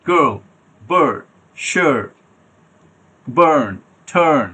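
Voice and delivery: "গার্ল, বার্ড, শার্ট, বার্ন, টার্ন" each with central uh, silent r; word list, "গার্ল" as "guhl", "বার্ন" as "buhn"; hesitation vowel. Shown as a demonstration. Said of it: "hesitation আ". ("গার্ল, বার্ড, শার্ট" hesitation আ দিয়ে, র ছাড়া)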